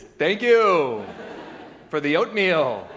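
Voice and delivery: loud, high swoop on each phrase; hammed-up childlike thanks; German-speaking toddler. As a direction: proudly recite it like a toddler learner. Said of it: "a German-speaking toddler".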